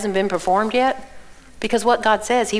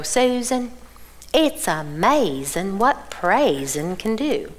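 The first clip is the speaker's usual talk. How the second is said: put-on Southern accent; 2 semitones lower, 4 dB quieter, much slower than usual. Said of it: "she really has a Southern accent".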